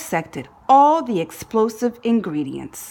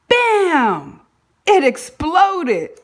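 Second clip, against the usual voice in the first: loud and high, then slowing on exploded; dramatic bam; 7 semitones higher, 5 dB louder, much slower than usual. A narrator's reading. Narrator telling the rocket blowing up, loud and high. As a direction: make the blast ring out.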